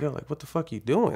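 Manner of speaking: replayed indignant outburst, not a full shout; retold incredulous outrage. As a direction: challenge them in disbelief, pitch jumping up.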